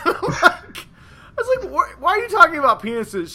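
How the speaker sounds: high, giggling through the words; laughing. Real, not acted. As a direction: laugh while you ask it. Laughs while retelling.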